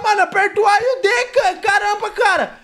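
frantic high loud yelling; panicking gamer. Real, not acted. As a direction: yell for help while being chased. Monster chasing, yelling at friend.

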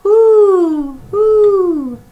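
very high, loud hooting; owl hoot. Acted. The owl calls out, very high hoots. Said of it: "asked the owl".

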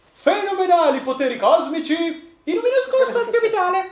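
high, loud Genie of the lamp voice. Retold or imitated. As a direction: belt it out like the Genie, grinning. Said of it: "Il genio della lampada". (voce del Genio della lampada, alta e ridente)

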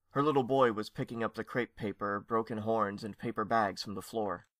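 plain reading voice

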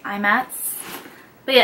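ordinary delivery

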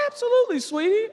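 high, sugary mother voice; sing-song, high-pitched mock mom; doting mom impression. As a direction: play an indulgent mom granting a wish. Friend's mother indulging her son.

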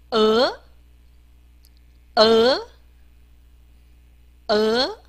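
呃 as a neutral "uh" rising steeply; 字母发音示范. Shown as a demonstration. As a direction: swing 呃 upward at the end, like a question. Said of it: "我们念呃". (念“呃”，尾音明显上扬)

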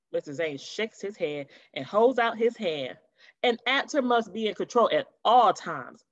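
plain reading voice

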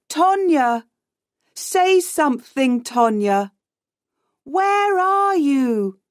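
high calls across the water; searching shout. A narrator's reading. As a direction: call out high for someone lost. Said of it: "shouts Max".

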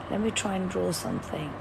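ordinary talk

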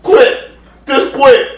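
pleading high and loud to quit; high, loud plea. Real, not acted. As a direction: beg loudly to stop. Giving up on the spicy challenge.

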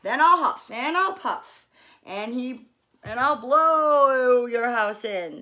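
loud, slow menacing wolf; growled threat. A narrator's reading. Wolf threatening the stick house, loud. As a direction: huff it out like a big bad wolf. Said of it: "growled the wolf".

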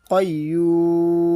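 কয়ুম chanted very slowly with the long vowel held; slow Quranic chant; drawn-out recitation. Shown as a demonstration. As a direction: stretch কয়ুম to three alif. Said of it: "এখানে তিন আলিফ টানতে হবে".

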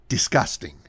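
usual speaking voice

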